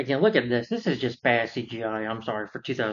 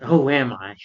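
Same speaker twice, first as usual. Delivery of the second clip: louder, demanding question; loud outburst; 7 dB louder, slower than usual. Retold or imitated. Bruce to Talbot before hulking out, in a loud demanding voice. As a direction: burst out with the question. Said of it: "he's like".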